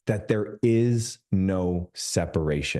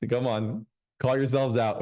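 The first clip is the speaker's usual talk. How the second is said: ordinary talk, nothing marked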